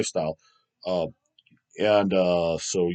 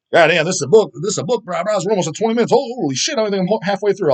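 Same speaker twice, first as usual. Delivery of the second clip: higher and louder, amazed at the length; surprised outburst; 8 semitones higher, 4 dB louder than usual. Real, not acted. Streamer amazed that a viewer's comment is so long, surprised outburst. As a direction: exclaim in disbelief at the wall of text. Shocked at a long chat message.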